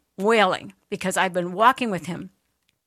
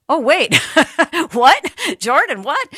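higher, louder disbelieving double take; 8 semitones higher, 7 dB louder, slower than usual. Retold or imitated. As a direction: stop short in amazement. Teammates realizing he's healed.

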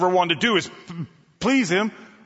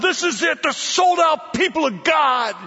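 overwhelmed awe; 12 semitones higher, 4 dB louder than usual. Acted. Preacher as himself walking in, shouting in awe. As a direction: gasp it out, dazzled by the crowd. Overwhelmed entering huge room.

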